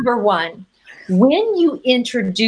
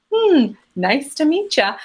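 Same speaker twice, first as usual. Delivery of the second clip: slow, noncommittal greeting opening on a raised "Hmm"; polite low-energy brush-off; 5 semitones higher, slower than usual. Acted. Dater unimpressed by a match's voice, polite brush-off. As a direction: politely give no more energy. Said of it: "you don't give more energy there".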